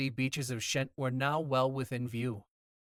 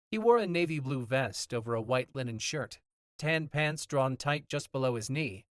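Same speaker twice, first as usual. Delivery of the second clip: plain reading voice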